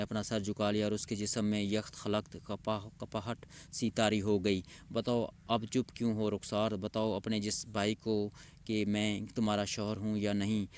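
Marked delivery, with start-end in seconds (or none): none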